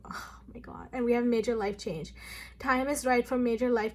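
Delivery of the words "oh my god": tearful whisper. Real, not acted. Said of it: "I'm crying".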